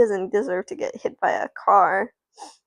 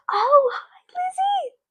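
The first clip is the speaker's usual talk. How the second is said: much higher, delighted gasp and slow hello; excited greeting; slower than usual. Real, not acted. Streamer greeting Lizzie in chat, high and delighted. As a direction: greet a friend who just showed up, thrilled.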